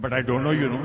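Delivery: loud mock brush-off; imitating a dismissive man. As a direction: throw the line back loudly. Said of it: "And he said".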